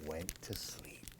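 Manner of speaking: soft whisper; whispered ending. A narrator's reading. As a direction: whisper the last words as he sleeps. Story ends softly.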